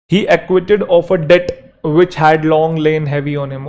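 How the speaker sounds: very slow, word by word; slow dictation. Shown as a demonstration. Teacher dictating a sentence for notes. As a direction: dictate it slowly. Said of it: "dictating example sentence".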